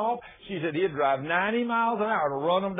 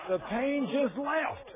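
high, drawn-out astonishment; amazed exclamation; 9 semitones higher, much slower than usual. Retold or imitated. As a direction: stretch it out high in wonder. Preacher repeats it in amazement.

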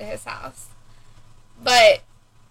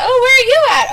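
much higher, louder curious voice; mock friend question; 12 semitones higher, 8 dB louder than usual. Mother imitating a friend looking for her in a photo, high. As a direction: peer at the photo puzzled.

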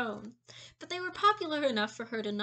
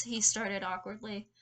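plain reading voice

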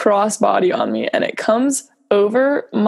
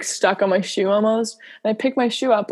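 ordinary delivery

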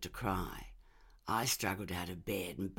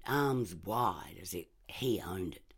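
plain reading voice

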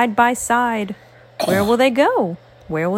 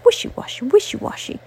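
whispered refrain; 10 semitones higher, faster than usual. Acted.

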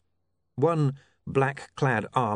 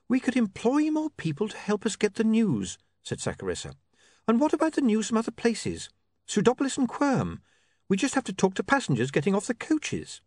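put-on a higher, eager young woman's voice; lightly voiced young woman; 10 semitones higher than usual. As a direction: pitch her up, keen with new ideas. Saccharissa's dialogue.

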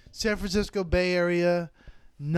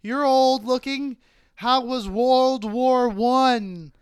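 slow, higher mocking crowd work; roasting comic; 7 semitones higher, 5 dB louder, much slower than usual. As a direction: tease the old man slowly. Comic roasting audience member.

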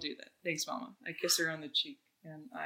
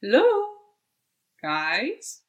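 sing-song hello; 5 semitones higher, 8 dB louder, much slower than usual. Acted. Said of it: "I'm just gonna go".

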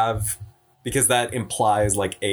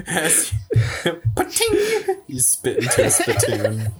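laughing; 7 semitones higher than usual. Real, not acted. Laughing while adding a joke.

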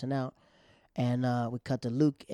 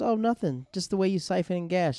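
higher, sing-song mocking tease; teasing jab; 6 semitones higher, 4 dB louder than usual. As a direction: needle her with fake innocence. Quoting the other girl's jab.

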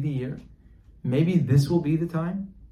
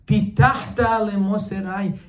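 high, loud, slow proclamation; declaimed verse; 6 semitones higher, 6 dB louder, slower than usual. Acted. Preacher quoting King David to Hashem, declaiming. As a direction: proclaim the Hebrew slowly at full voice.

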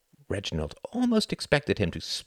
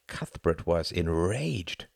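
plain reading voice

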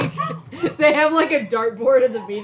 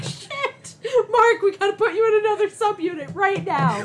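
higher, hammed-up panicked exclamation; mock panic; 7 semitones higher than usual. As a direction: play a frantic manager who just hit Mark again. Voicing staff hitting Mark by dart.

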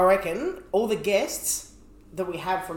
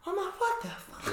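mock shock; 13 semitones higher, 8 dB quieter than usual. Retelling his reaction.

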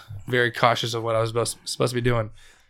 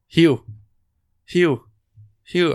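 a hint of an eerie monotone call, pitched up; calling the name flat and repeated, mildly higher; 5 semitones higher, 5 dB louder, much slower than usual. Ghostly voice calls from mom's room, eerie monotone. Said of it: "very monotone".

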